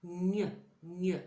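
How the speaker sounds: "Gne, gne" repeated as a mouth exercise; repeated sound. Shown as a demonstration. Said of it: "Vous le dites plusieurs fois".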